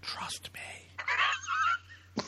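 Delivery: breathy stage whisper. Acted. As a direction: hiss it in a whisper. Repeats phrase as a whisper.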